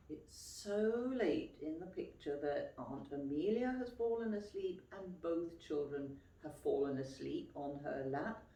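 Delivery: ordinary delivery, nothing marked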